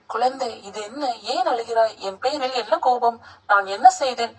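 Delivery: ordinary delivery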